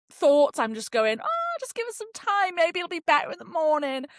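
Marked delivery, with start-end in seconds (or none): 1.2-4.0 high, wishful plea sliding down; mock pleading. Injured girl putting off the hospital, mock pleading. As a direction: plead lightly for more time.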